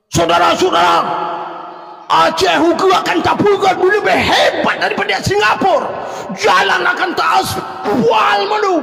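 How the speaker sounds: stretched, thundering Allahu at the end; fiery rally shout. Acted. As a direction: rouse the crowd at full force. Fiery rally speech imitation.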